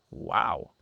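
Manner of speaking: dry, mock-impressed wow; sarcastic wow, unimpressed. Real, not acted. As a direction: fake amazement, tongue in cheek. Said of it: "I'm being a little sarcastic".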